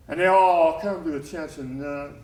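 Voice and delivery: ordinary talk, nothing marked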